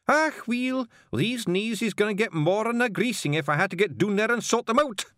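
high, irate Scots threat; cross hairy Scotsman; Scottish snarl. Acted. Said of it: "snarled the hairy man".

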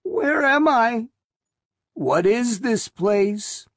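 high, slow, lost and scared; trembling with confusion; quavering frightened voice. Acted. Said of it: "the voice quavered".

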